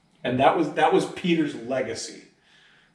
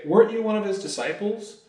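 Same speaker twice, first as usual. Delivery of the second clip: higher, faster accusing question; nosy bystander pressing him, higher and quicker; suspicious question; 8 semitones higher, faster than usual. Retold or imitated. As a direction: catch him out, quick and pointed.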